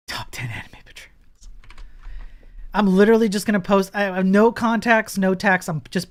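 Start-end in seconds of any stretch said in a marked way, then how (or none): 0.1-1.5 whisper. Acted. Mock-betrayed aside to chat.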